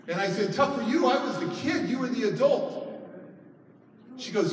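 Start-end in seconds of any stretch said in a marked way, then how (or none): 0.5-2.8 high, incredulous retort; retold indignant comeback. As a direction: throw it back at them, affronted. Retold argument with birth mother.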